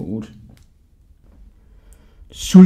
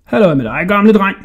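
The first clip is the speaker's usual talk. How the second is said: raised, cheeky hail; calling out; 5 semitones higher, 5 dB louder than usual. A narrator's reading. Hodja teasing the old jailer, calling out loudly. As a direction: call out cheekily to the old man. Boy calls to passing jailer.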